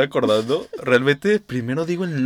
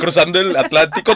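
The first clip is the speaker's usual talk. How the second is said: normal talking voice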